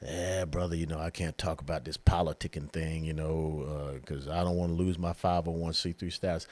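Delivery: low, soft mock-pastor mumble, droning along; reluctant pastor. Pastor excusing himself to a brother from politics, low reluctant voice. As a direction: imitate a timid pastor, low and hushed. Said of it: "We got so many pastors out there".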